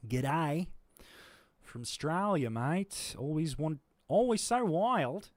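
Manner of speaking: high, loud Eastern Australian twang; Eastern Australian accent. Shown as a demonstration. Streamer demonstrating the Eastern Australian version, in a hammed-up Australian accent. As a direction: play up the Eastern Australian vowels. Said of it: "Eastern is like".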